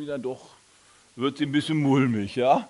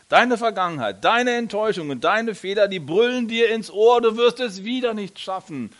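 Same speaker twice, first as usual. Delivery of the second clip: rising intensity; 6 semitones higher, 4 dB louder than usual. Acted. Preacher builds intensity.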